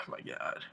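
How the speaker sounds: heavy sigh into a near-voiceless "oh my god"; exasperated sigh. Real, not acted. Wrestling fan dreading the Styles versus Cena topic, exasperated sigh. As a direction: sigh it out, very soft.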